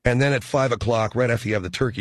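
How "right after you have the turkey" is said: usual speaking voice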